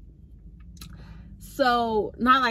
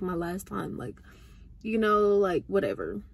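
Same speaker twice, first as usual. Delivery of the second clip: ordinary talk, nothing marked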